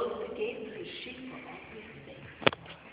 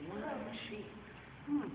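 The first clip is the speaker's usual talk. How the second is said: plain reading voice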